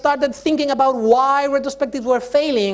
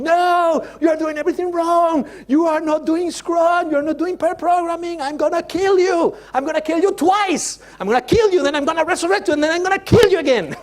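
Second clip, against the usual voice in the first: drill sergeant yelling; 7 semitones higher than usual. Acted. Scrum Master yelling at the team, hammed-up drill-sergeant shout. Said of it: "Scrum Master yelling at the team".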